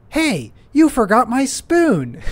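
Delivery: high, loud, slow demanding yell; imitated yell. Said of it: "she just yells this".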